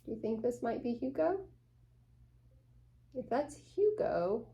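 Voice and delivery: usual speaking voice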